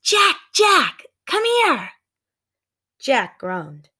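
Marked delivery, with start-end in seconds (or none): 0.0-1.9 high, loud excited call. Acted. Sister calling brother into woods, high loud call. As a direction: call him urgently from far off.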